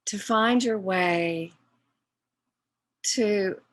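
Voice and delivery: slow, measured meditation guide with long pauses; guided meditation. Real, not acted. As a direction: guide them inward phrase by phrase, leaving long silences.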